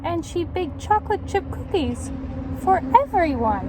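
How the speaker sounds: put-on lively read-aloud to children; story narration. A narrator's reading. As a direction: tell it like a picture book. Reads picture book to children.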